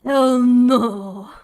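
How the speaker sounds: loud, indignant hell no; loud outburst. Retold or imitated. Reacting to the kid sniffing gels, loud outburst. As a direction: blurt it out, appalled. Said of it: "And I was like".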